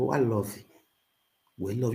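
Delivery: normal talking voice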